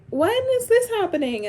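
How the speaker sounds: high-pitched mimicry of a pestering texter; exaggerated imitation of impatient friends; mock nosy questioner. Mimicking people who text her.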